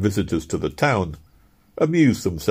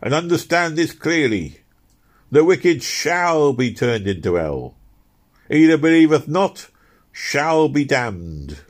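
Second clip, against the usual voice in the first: raving hellfire preaching, higher and slow; shouting preacher; 6 semitones higher, 4 dB louder, slower than usual. Acted. Frenzied preacher warning the crowd of hell, shouting. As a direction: hammer each word of damnation home. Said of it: "he shouted".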